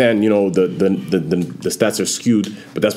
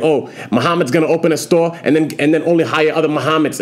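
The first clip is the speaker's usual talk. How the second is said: higher Boston guy voice; Boston accent; 6 semitones higher than usual. Retold or imitated. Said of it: "And he's like".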